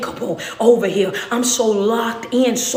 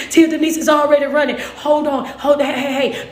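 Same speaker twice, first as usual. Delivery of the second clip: ordinary delivery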